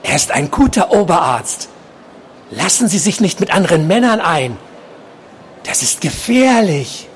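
high, uncanny insistent voice; obsessed patient, warning. A narrator's reading. Patient warning nurse off other men, high creepy voice.

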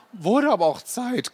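an impatient old lady's put-on voice; granny grumbling. A narrator's reading. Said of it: "knurrte sie". (ungeduldige Oma, hoch und lauter gespielt)

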